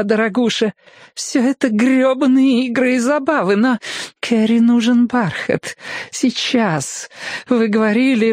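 high-pitched nagging lady; petulant tipsy feminine complaint; whining drunk woman. A narrator's reading. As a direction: whine and insist in a high voice. Drunk woman nags, demanding velvet.

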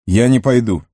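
curt refusal. A narrator's reading. Boromir refuses to enter Moria, curtly. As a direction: refuse point-blank, cutting it short. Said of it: "отрезал Барамир".

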